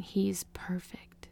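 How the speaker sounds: slow hushed whisper; gentle whisper. Retold or imitated. Nurse softly telling the mother, whispered. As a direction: breathe it softly like good news. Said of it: "the nurse just saying".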